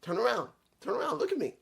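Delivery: high, stifled laughing sputters, then a fast, breathless "turn around"; imitating someone cracking up mid-line; choking back laughter. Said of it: "He's like".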